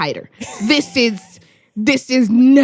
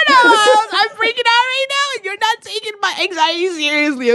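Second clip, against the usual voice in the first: much higher, half-crying panic; panicky whine; 9 semitones higher than usual. Retold or imitated. To Miles, panicking with a mock cry. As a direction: whine it as if freaking out. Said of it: "I'm like".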